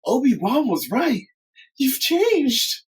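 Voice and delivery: high and hurt, near tears; hammed-up high heartbroken wife; tearful Padme. Padme line.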